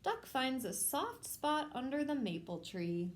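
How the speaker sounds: ordinary delivery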